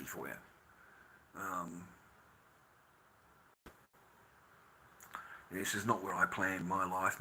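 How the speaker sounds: low, soft, heavy-hearted confession; sad, subdued. Real, not acted. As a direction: speak low and quietly, with long pauses. Subdued, regretful personal confession after rant.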